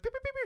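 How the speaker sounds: tiny high-pitched pew-pews; squeaky imitation of shots, softly; gunfire noises. Soldiers start firing, imitated in high little pews. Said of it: "then they start firing".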